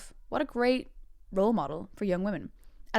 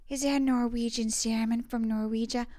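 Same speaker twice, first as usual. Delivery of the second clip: Jennifer Coolidge; 3 semitones higher than usual. Acted. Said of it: "why doesn't it sound like it?".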